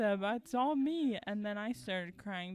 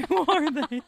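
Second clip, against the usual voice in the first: laughing; 4 semitones higher, 13 dB louder than usual. Real, not acted. Laughing repeat of sister's question.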